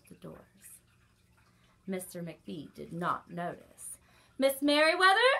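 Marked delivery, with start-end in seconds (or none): 4.4-5.3 high, loud call; urgent loud call. Acted. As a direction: call her name down the hall. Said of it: "he called as he walked".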